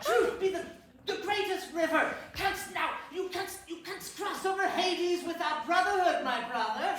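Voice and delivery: loud preachy proclamation; mock biblical prophet. Grand speech about truth to brother.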